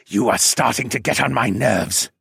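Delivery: a touch of a hissing dragon; hissing serpent dragon. A narrator's reading. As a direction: lightly hiss the words through the teeth. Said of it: "the dragon hissed".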